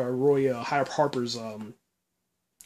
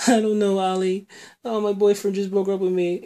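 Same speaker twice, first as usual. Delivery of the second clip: higher, lightly voiced hurt sister; a hint of upset Thea; 6 semitones higher than usual. Sister telling brother about the breakup, lightly voiced higher and hurt. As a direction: mildly mimic a young woman just dumped, higher.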